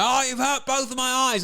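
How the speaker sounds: high-pitched hammed-up complaint; mocking, overdramatic cry of pain; mock victim cry. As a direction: wail it like a badly faked injury. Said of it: "they have him be like".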